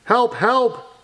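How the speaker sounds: high-pitched pretend distress; cry for help. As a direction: call for help in mock alarm. Story character calls for help.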